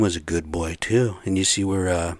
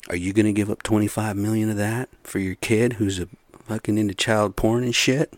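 normal talking voice

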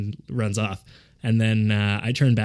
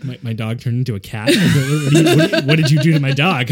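stammering, louder retold accusation; agitated stammer of disbelief; mock outraged yell; 3 semitones higher, 6 dB louder than usual. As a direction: imitate an upset dog owner, half laughing. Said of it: "like, yelling at me".

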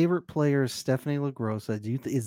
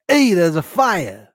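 loud, slow, higher hearty fireman call; 6 semitones higher, 8 dB louder, slower than usual. Retold or imitated. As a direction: bellow it like a cartoon firefighter. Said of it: "They sound like this".

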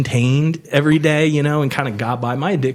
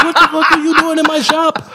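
much higher, louder laughing outrage; mock-outraged high voice through laughter; startled shopkeeper; 5 dB louder than usual. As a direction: shop owner shocked at the intruder. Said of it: "This guy's like".

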